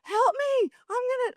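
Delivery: high-pitched mock plea for rescue; imitated panic. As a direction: mimic a panicked swimmer calling out. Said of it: "people freak out in the swim".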